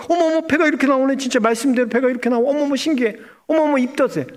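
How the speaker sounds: high-pitched, rapid flutter of astonishment; amazed Sarah. Retold or imitated. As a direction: act out a woman marveling at herself. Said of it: "하나님 쳐다보니까". (신기해서 호들갑 떠는 높은 목소리)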